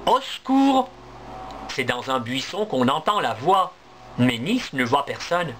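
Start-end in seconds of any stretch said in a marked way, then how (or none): 0.1-0.9 cry for help. Acted. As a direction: call out high for help from the bush. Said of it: "il entend une voix".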